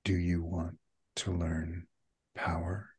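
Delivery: soft, halting, eerie offer; quiet creepy stranger, pausing between phrases; creepy phone voice. Acted. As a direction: speak softly and ominously down the line. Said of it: "really creepy".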